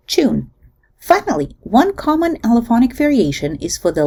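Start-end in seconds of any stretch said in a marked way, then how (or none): 0.1-0.5 "tune" said as choon, with a Ch at the start; coalesced Ch. Shown as a demonstration. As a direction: begin "tune" with a Ch sound. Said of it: "pronounced with a coalesced Ch at the beginning".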